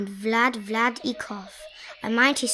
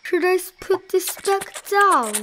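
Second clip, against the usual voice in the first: raised pitch, worried elephant; alarmed; 8 semitones higher, 5 dB louder than usual. A narrator's reading. Elephant wondering whether to put the speck down, alarmed. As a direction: fret aloud in alarm. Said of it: "Horton thought with alarm".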